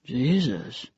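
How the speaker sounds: stunned man breathing out after her jump; soft, breathed oath. Acted. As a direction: breathe it out like a prayer. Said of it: "softly expelled breath that sounded more like a prayer".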